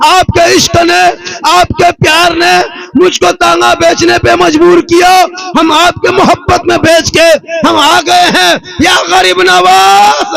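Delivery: high, loud, drawn-out wailing plea; a poor man's desperate prayer sung out high and loud; impassioned preaching. Acted. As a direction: cry it out to the saint with full force. Preacher cries out a plea to Garib Nawaz.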